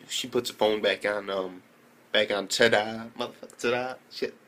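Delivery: ordinary delivery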